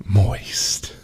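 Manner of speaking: whispered, breathy ASMR word; sultry whisper. Shown as a demonstration. Streamer's ASMR segment, saying chat's word in a sultry whisper. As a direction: whisper it slow and seductive. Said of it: "sultry ASMR".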